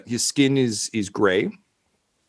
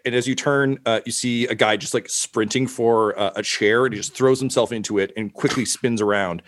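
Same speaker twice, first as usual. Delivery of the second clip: normal talking voice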